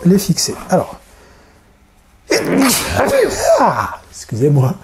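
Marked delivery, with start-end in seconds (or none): 2.3-4.1 a sudden real sneeze; sneezing. Real, not acted. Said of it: "j'ai éternué". (éternuement soudain)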